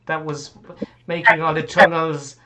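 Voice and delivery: put-on high-pitched accusing child; lava child. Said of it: "it's one of the lava children".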